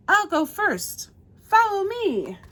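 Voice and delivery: high, loud, drawn-out call; storybook shout. A narrator's reading. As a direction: lead the charge for young listeners. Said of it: "Then Blue shouted".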